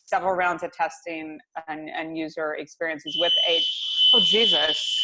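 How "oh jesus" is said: startled exclamation. Real, not acted. Fire alarm starts, startled.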